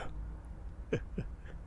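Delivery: breathless, hushed chuckle; a quiet amused laugh under the breath; soft villain chuckle. Acted. Tied-up villain chuckling at the tense hero, hushed laugh.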